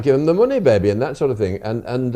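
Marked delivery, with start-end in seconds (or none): 0.0-0.9 higher, louder echo of the catchphrase; Wilfred Pickles impression. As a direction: repeat the old host's catchphrase in his manner. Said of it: "he did talk like that".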